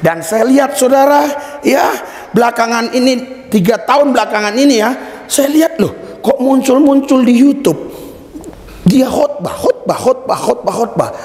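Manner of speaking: high, driving sermon delivery; impassioned preaching. Real, not acted. Preacher tells congregation of repeated meetings, high impassioned preaching.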